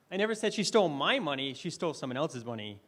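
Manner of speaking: emphasis on my in the sentence; stress on my. Shown as a demonstration. Speaker demoing stress to the audience, stress on my. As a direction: point at my. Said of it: "I emphasized different words".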